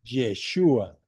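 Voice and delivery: "Yeshua" with a clear sh and a falling end; Hebrew pronunciation. Shown as a demonstration. Teacher telling students how Jesus sounds in Hebrew, demo. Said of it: "en hebreo se dice".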